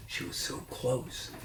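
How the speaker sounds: whispered, slow suspense; breathless hush of suspense. A narrator's reading. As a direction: lean in and whisper it. Suspense narration.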